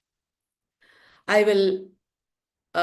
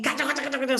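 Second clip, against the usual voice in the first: rapid higher-pitched "kacha-kacha" clatter; imitated disturbing noise; 3 semitones higher, 4 dB quieter, much faster than usual. Shown as a demonstration. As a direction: rattle off nonsense noise. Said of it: "so many disturbing sounds".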